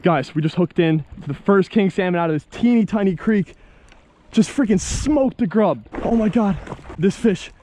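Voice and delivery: high squealing shouts of joy; shrieking excitement. Real, not acted. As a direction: scream with thrill at a big catch. Fish strikes, real excitement.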